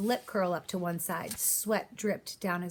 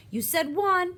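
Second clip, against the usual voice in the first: high, loud angry yell; 11 semitones higher, 6 dB louder, slower than usual. Acted. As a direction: yell it at the old man who fired twice. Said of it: "Crab yelled".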